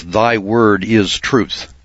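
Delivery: slow, weighty "Thy word is truth"; solemn scripture quote. Shown as a demonstration. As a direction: read "Thy word is truth" slowly, leaning on "word". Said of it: "Now, the scripture tells us".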